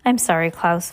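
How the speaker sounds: usual speaking voice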